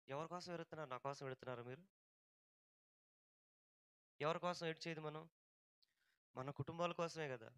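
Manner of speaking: very soft, low appeal to the congregation; subdued, earnest sermon voice; hushed preaching. Real, not acted. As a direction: drop right down and plead quietly. Hushed pleading inside the sermon.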